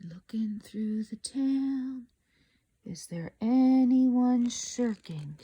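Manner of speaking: slow, soft hushed aside; whisper. Real, not acted. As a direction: murmur as if searching the picture. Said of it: "reader whispering aside".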